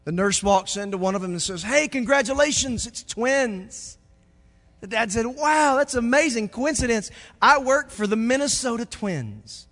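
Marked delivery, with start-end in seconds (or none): 1.6-4.0 high, loud excited nurse; cheery nurse. Acted. Pastor telling a joke, voicing the cheery nurse. 5.2-9.7 high, loud delighted dad; amazed dad. Acted. Pastor telling a joke, voicing an amazed dad.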